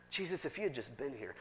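soft, low sorrowful plea; quiet grieving Mary. Acted. Mary grieving softly to Jesus, quiet plea. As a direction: say it gently, grieving.

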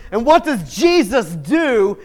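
loud, high building to a peak; preaching climax. Acted. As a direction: build the question up.

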